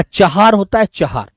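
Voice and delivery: चहार said with a clear h between the two a sounds; teacher correcting a spelling; stress on चहार. Shown as a demonstration. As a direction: put weight on चहार. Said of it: "चार दीवारी मत लिखना बेटा".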